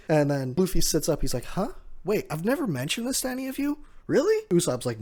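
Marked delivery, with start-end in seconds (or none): 1.5-4.5 high, fast disbelieving squeak rising on Really; playing Luffy's stunned disbelief, pitch shooting up; retold surprise. Luffy shocked nobody knew his dream.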